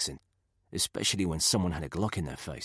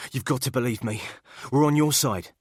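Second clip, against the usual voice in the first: high, loud panicked insistence; desperate pleading; 6 semitones higher, 5 dB louder than usual. Acted. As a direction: plead to be believed. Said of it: "the man said".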